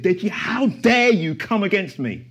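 slow, menacing devil challenging him; Satan's voice. Retold or imitated. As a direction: drag out a taunting dare as the enemy. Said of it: "He said".

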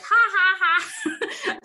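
high, loud mock laugh of a fearless friend. Mountaineer friend laughing at her fear, high mock laugh. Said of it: "she would be like".

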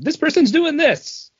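mock hype. Mock announcement quote.

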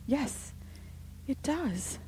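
agree, frightened to the bone; horror-stricken. A narrator's reading. Missus Brigham agreeing with her sister, horror-stricken. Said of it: "whose horror-stricken tone matched her sister's".

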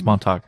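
"Montauk" closed with a K sound. Shown as a demonstration. Host correcting co-host, "Montauk" with a hard final K. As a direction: say "Montauk" as MON-tock. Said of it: "I always thought it was a K".

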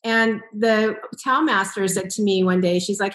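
ordinary talk

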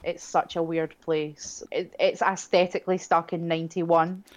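ordinary talk

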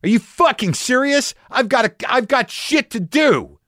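high-pitched, exasperated retold tirade; angry cursing outburst. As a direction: replay a furious fit at the hotel desk. Retelling his outburst at hotel staff.

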